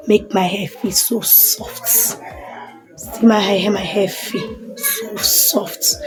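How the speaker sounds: breathy hushed admiration; soft whisper. Real, not acted. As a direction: whisper about the softness, slowly. Touching soft hair, almost whispered.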